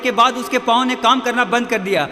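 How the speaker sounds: urgent, driving race narration at full force; impassioned preaching. A narrator's reading. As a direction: build the race like a rousing preacher. (जोशीला, ज़ोरदार उपदेश)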